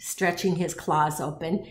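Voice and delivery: ordinary delivery, nothing marked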